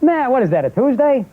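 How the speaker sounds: high-pitched, grumbling dad impression; dismissive father. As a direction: brush it off like a busy dad. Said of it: "He went".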